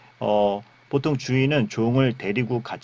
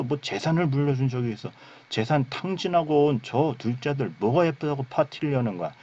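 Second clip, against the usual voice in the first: acted grievance, pitched higher throughout; angry older brother; 4 semitones higher than usual. Older brother protesting to his father, acted anger. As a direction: complain hotly as the slighted son. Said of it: "이렇게 화를 내는 것을".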